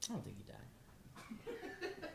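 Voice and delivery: breathy whispered aside through a laugh. Real, not acted. Joking that dad is alive, laughing whisper.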